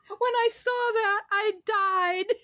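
high shrieking laugh, then laughing words; laughing hard. Real, not acted. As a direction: crack up at the name. Bursts out laughing at name.

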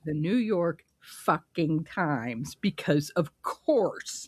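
The New York fucking Times said slowly, every word weighted; slow emphatic. Real, not acted. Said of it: "I must reiterate".

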